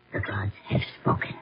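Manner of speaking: hushed, breathy final verdict; ominous hush. Acted. The queen hushes her final verdict to her daughter's suitor. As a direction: let the doom fall softly, almost whispered. Queen pronounces doom on lovers.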